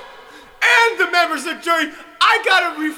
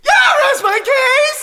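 laughing mock sob; 9 semitones higher, 4 dB louder than usual. Lawyer rests case tearfully.

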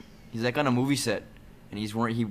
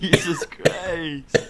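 laughing; 8 semitones higher, 4 dB louder, much slower than usual. Real, not acted. Laughing at squeaky voice.

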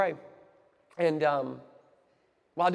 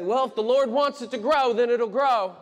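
louder, higher Southern pastor voice; Southern accent; 7 semitones higher, 8 dB louder than usual. Acted. Quoting small-church pastors to the congregation, Southern accent. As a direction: put on a Southern accent, raised and loud. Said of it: "They always say it with a Southern accent".

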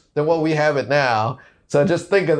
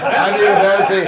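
laughing quote; 6 semitones higher, 5 dB louder than usual. Retold or imitated. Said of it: "And I was like".

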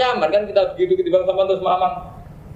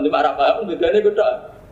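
normal talking voice

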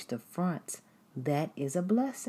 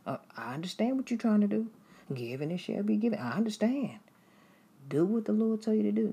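higher, quick and eager, a light stammer; voicing a true believer; 6 semitones higher, faster than usual. True follower cheers the giver on, higher and eager. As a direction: gush like an overjoyed fellow believer. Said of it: "They're like".

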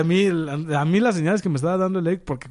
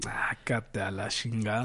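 low, slow, hushed brush-off; slow disgusted mutter; scornful dismissal; 7 semitones lower, 11 dB quieter, much slower than usual. Real, not acted. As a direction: drop it low and drag it out in disgust. Dismissive retort to friend.

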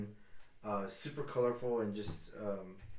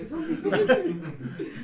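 laughing mock taunt; 7 dB louder, much slower than usual. Mock taunt echoed among friends.

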